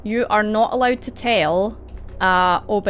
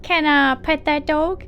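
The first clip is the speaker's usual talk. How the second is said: silly catchphrase voice; 9 semitones higher than usual. Acted. Game master joking about petting a dog, silly high voice.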